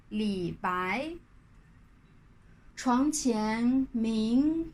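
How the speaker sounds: very slow Mandarin verse with long pauses; read-after-me recitation. Shown as a demonstration. Teacher leading learners to repeat the poem, slow Mandarin recitation. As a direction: model each poem line slowly, tones stretched, leaving room to repeat. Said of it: "lire à haute voix après moi".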